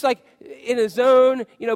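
ordinary talk

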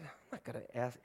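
hushed refusal. Retold or imitated. Man whispering refusal.

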